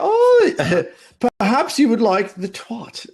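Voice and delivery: high, posh British phone voice; hammed-up proper British, amused; mock British accent. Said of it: "the British one's funny".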